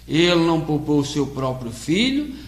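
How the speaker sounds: usual speaking voice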